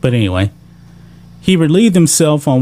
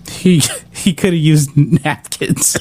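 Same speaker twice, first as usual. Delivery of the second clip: laughing; slower than usual. Real, not acted. Laughs reading quote.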